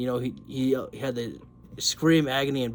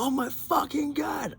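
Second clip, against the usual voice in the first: excited outburst; 14 semitones higher than usual. Retold or imitated. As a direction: voice jumps high, pure excitement. Retelling seeing Moxley arrive, excited.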